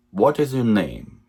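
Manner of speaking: normal talking voice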